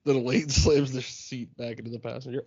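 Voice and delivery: ordinary delivery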